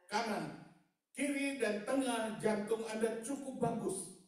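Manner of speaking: loud, high-pitched testimony from the pulpit; full-force preaching. Real, not acted. Preacher retelling the doctor's verdict to the congregation, full-force preaching. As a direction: preach it loud and high, stretching the key phrases.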